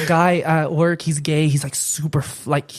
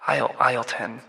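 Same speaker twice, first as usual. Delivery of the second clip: softer deadpan store page; Walmart announcer; 2 semitones lower, 6 dB quieter than usual. Pretend. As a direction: murmur a location into the intercom. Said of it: "be, like, the Walmart guy".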